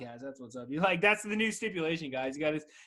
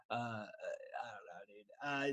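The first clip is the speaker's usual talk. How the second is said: mock sob; 7 semitones lower, 12 dB quieter, much slower than usual. Mock despair after the joke.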